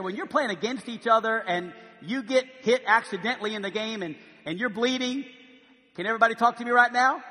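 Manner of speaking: normal talking voice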